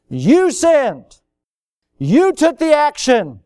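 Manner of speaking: loud, high preaching cry; forceful accusation. Real, not acted. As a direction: drive each word at the listeners. Preacher accuses listeners directly.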